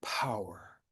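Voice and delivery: hushed whisper of Power. Shown as a demonstration. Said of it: "There's a power there".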